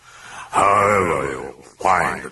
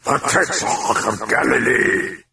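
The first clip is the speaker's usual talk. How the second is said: higher, scornful outburst; a demon scornfully correcting the priest; angry scorn; 7 semitones higher than usual. A narrator's reading. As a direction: blast him with contempt. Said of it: "blasted him with anger and scorching contempt".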